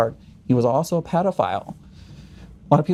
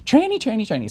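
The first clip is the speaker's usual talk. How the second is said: high-pitched mock chanting; mimicked outburst; 9 semitones higher than usual. Congresswoman answering an objection at a hearing, mimicked high outburst. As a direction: rattle it off high like a heckling retort.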